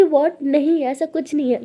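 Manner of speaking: embarrassed loud denial; flustered denial. Acted. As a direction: protest loudly, caught off guard.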